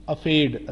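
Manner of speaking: افیڈ with no r after the f; wrong take, skip the r in افیڈ; counter-example افیڈ said as "a-fade"; missing r. Shown as a demonstration. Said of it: "یہاں پر یہ نہیں کریں گے".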